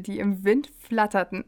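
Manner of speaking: ordinary delivery, nothing marked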